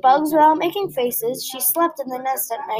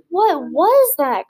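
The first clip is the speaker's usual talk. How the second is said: higher, louder, and slow; a baby bird jolted awake by something odd; startled cry; 5 semitones higher, 4 dB louder, much slower than usual. A narrator's reading. Baby bird startled by the bat, slow high cry. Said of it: "cried Flap".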